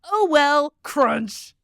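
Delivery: loud, high "oh well", then crunch; resigned crash re-enactment. Retold or imitated. Driver re-enacting being hit from behind, loud mock resignation. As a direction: belt out a mock-resigned reaction. Acting out the rear-end crash.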